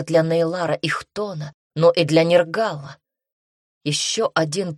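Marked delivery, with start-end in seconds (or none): none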